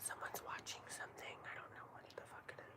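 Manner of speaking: fast, soft unvoiced whisper. Real, not acted. Vlogger hearing a neighbour, quick hushed whisper. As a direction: hush it so no one hears.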